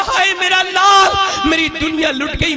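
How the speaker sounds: high, slow wail of grief; mother wails over her dead son, high and slow; mother's wail. Retold or imitated. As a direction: stretch it out like a grieving mother. Mother wails at son's death.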